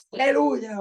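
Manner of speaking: loud, high hallelujah shout; strong jubilant cry. Shown as a demonstration. Preacher showing strong praise with the voice, jubilant cry. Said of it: "son expresiones fuertes, ¿verdad? Con tu voz".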